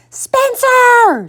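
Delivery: high-pitched angry mom scream; furious mother's high yell. A narrator's reading. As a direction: shriek the boy's name, drawn out. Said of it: "she screamed".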